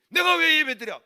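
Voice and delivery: high, loud retort; indignant refusal. Retold or imitated. Mother refusing son's plea to worship, indignant shout. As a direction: yell back in protest.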